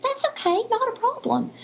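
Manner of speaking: forgiving wife, pitched well up; wife's voice. Retold or imitated. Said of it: "She said".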